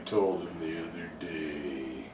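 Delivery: low, slow, soft monotone; deadpan. Retold or imitated. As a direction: drone it out deadpan like Stephen Wright. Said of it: "I imagine being very deadpan".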